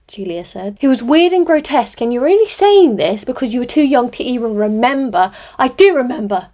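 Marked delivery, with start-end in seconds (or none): 0.8-5.3 high, loud furious retort; angry shout. A narrator's reading. Polly shouting at sister. 5.6-6.5 loud sudden shout, stress on do. A narrator's reading. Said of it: "Julia shouted suddenly".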